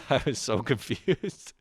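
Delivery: slow, breaking into a laugh. Real, not acted. Comic admitting he was lost in a game, laughing. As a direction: laugh through the words.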